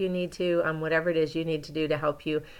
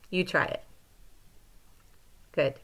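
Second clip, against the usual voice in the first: ordinary talk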